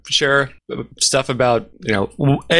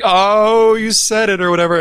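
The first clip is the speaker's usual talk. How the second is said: high, loud mocking sound clip; soundboard jingle voice; 10 semitones higher, 3 dB louder than usual. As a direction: call him out like a jingle. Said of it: "the soundboard would hit".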